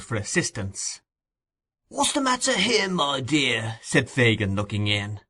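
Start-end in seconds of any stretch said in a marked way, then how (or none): 1.9-3.8 put-on much higher, oily old man. A narrator's reading. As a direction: purr it like the sly old fence.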